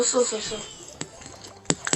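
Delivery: slow trailing whisper; fading whisper. Shown as a demonstration. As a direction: let the words die away into a whisper. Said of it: "repeats word fading to whisper".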